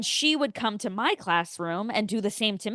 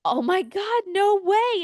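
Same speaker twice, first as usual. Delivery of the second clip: much higher, overjoyed oh my God; mock excited; 11 semitones higher, 4 dB louder, slower than usual. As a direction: play along with gushing fake delight. Feigned delight at fake hometown.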